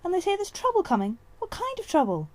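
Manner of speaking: high, loud urgent questions; anxious pressing. A narrator's reading. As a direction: demand answers fast. She-cat keeps pressing excitedly.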